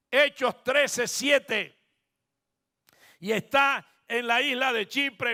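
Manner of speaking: loud, high pulpit proclamation; impassioned preacher's cry; preaching at full force. Acted.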